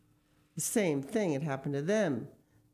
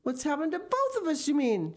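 higher, louder exasperated retort; husband shouting back at his wife; shouted; 12 semitones higher, 4 dB louder than usual. Acted. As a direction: throw it back at her. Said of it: "shouted Mister Gregg".

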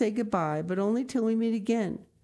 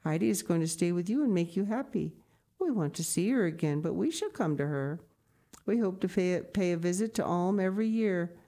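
reassuring lady; 4 semitones higher than usual. Acted. Grandmama promising the grandmother they will return, reassuring. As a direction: brush away her fears warmly.

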